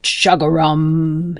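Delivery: slow, stretched, joyful croak; glad frog croak. Acted. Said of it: "he cried again".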